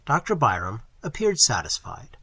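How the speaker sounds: usual speaking voice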